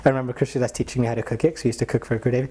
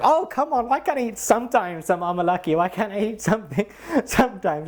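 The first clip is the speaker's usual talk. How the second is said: much higher whiny plea, breaking into a laugh; mock pleading; 8 semitones higher than usual. Caught guru pleads for his sweets, high whiny laughing plea. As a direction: beg to be allowed a treat.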